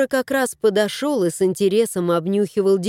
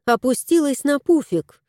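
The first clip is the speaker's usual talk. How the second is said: usual speaking voice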